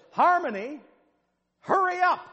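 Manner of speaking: put-on high, loud calling out to a child; mother's yell. Mother calling her lagging daughter, loud high yell. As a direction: holler across the mall after the girl. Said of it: "she yells".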